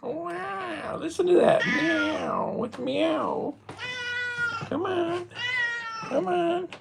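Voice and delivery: very high, excited pet voice; baby talk to the cat. Acted. Owner calling the cat over in high baby talk.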